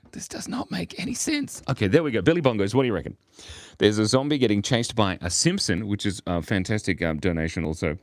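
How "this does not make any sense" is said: very high, wheezy laughing voice; helpless amusement. Real, not acted. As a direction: squeak it out through the giggles. Laughing at absurd drawing.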